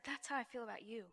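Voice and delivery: hushed and low, barely voiced; very soft. The Lord speaking gently to her, hushed and soft. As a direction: say it tenderly under your breath. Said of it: "just real soft".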